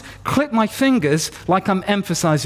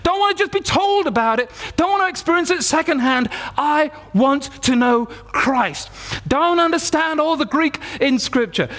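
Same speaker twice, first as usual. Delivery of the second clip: impassioned prayer; 6 semitones higher than usual. Retold or imitated. Young believer praying over a friend for healing, impassioned prayer. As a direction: pitch high and pleading, pressing on phrase after phrase. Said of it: "And I'm just saying".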